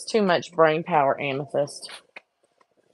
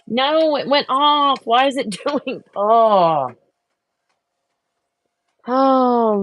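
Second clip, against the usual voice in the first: higher, louder startled cry; startled dismay; 8 semitones higher, 7 dB louder, slower than usual. Real, not acted. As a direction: yelp as it goes wrong. Cookie print goes wrong.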